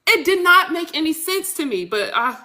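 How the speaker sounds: high-pitched exasperated rant; getting upset, with a little laugh; worked-up upset. Real, not acted. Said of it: "I'm getting upset all over again".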